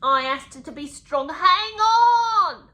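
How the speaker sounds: hammed-up plea building to a yell; high, loud soap-opera shout on the last words; mock-desperate cry. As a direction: cry out in overblown mock anguish. Mockingly acting out the memoir's desperate plea.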